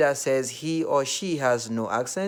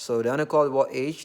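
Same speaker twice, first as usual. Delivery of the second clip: normal talking voice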